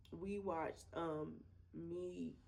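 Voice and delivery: normal talking voice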